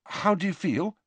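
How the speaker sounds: high, anxious question; anxiously checking on him. A narrator's reading. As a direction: ask with worried concern. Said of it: "he said anxiously".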